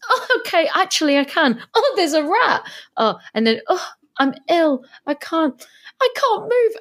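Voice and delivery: high, moaning complaints one after another; whiny Susan. Retold or imitated. Fan mocking Susan's complaints in the prison cell, high whine. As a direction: whinge like a helpless invalid. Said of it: "then she's like".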